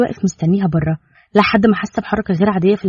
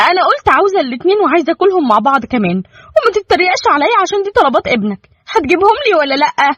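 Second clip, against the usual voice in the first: high, upset, demanding wife; upset outburst; 10 semitones higher than usual. A narrator's reading. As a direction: snap back at him, high and indignant. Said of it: "روفان اتضايقت جدًا وقالت". (بغضب وضيق بصوت عالي)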